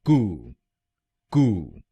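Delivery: कू said as a long koo; कू held and clear; first syllable alone. Shown as a demonstration. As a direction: isolate कू, long oo. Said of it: "हम बोलेंगे".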